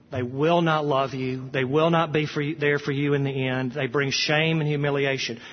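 ordinary delivery

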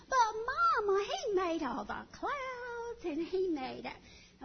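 put-on high, slow pleading little boy; insistent toddler. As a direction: whine it to mama. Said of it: "child insists".

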